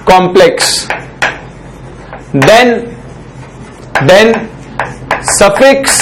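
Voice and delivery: slow, word-by-word dictation; note dictation. Shown as a demonstration. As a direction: read each word out slowly for students to write down. Teacher dictates notes slowly. (धीरे-धीरे notes लिखवाना)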